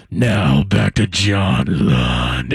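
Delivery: very deep, slow announcer liner; Tommy Tighe. Acted. Host voicing the liner he wishes for, deep announcer voice. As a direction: draw it out low like a big radio bumper. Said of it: "a Tommy Tighe liner that says".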